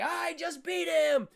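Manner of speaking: loud, high, slow triumphant yell; high shouted boast of victory; gloating witch shout. Retold or imitated. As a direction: crow it over the crowd like a victorious witch. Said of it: "the witch is like".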